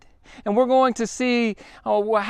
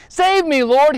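a cry for rescue, higher and louder; shouting; 5 semitones higher, 5 dB louder, slower than usual. Retold or imitated. Drowning Peter crying out to Jesus, loud and high. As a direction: call out as if sinking. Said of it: "he shouted".

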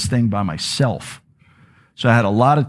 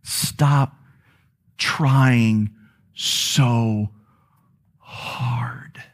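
slow, broken into single words, fading to a whisper; gentle voice of the Spirit; much slower than usual. Acted. God's Spirit speaking to the weeping preacher, gentle and fading. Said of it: "God's Spirit was saying to me".

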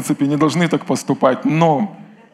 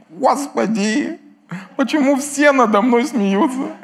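high, slow whimper of the devil; whining devil; 9 semitones higher, slower than usual. Pretend. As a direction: play the devil wailing in self-pity. (ноющий голос дьявола)

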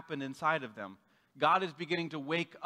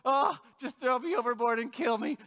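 slow, high, louder hammed-up woe; milk it like a tragic martyr; mock Jonah wailing his fate; melodramatic self-pity; 7 semitones higher, 4 dB louder than usual.

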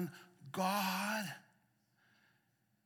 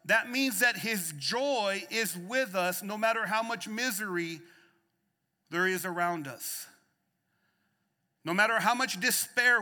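rising preacher's cadence, louder on the peaks; preaching at full force; 3 semitones higher, 7 dB louder than usual. Real, not acted. Pastor preaching hope to the church, full force. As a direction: preach it out to the congregation.

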